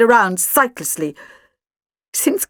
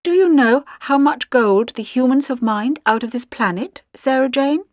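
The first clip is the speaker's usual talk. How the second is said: plain reading voice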